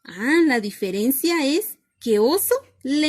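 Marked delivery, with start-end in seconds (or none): none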